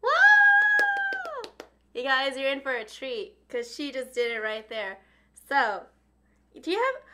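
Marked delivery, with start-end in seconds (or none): 0.0-1.5 high, loud woo; excited cheer. Real, not acted. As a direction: cheer her on. Cheering after the tuturu.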